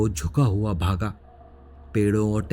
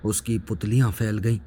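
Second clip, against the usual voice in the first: plain reading voice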